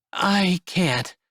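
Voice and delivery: a hint of her voice; young woman voice. Esther voiced.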